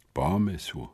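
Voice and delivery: quiet bitter echo of the word. A narrator's reading. Boy bitterly echoing his friend's word, quiet. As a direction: repeat it with bitterness. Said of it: "powtórzyłem z goryczą".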